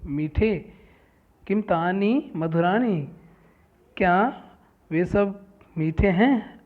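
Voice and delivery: loud, clear textbook reading for students; reading a lesson aloud. Shown as a demonstration. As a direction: read each Sanskrit line out, then gloss it. Reads Sanskrit text with translation. (पाठ को ज़ोर से पढ़कर सुनाना)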